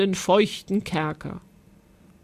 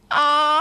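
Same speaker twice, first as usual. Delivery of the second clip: high, long scream of fright; terrified shriek; 6 semitones higher, 4 dB louder than usual. A narrator's reading. Giantess with a spider on her leg, shrieking. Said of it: "kreischte Grauseldes".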